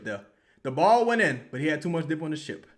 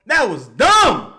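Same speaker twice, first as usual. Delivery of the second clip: slow, loud, disgusted; angry shout; 4 semitones higher, 11 dB louder, much slower than usual. Real, not acted. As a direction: spit out each word in anger. Angry verdict on the play.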